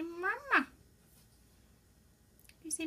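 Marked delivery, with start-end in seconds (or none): 0.0-0.7 high-pitched Mama for the cat to copy; modelling a word. Shown as a demonstration. Mother teaching the cat to say Mama, high-pitched. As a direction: sing it up high so the cat copies.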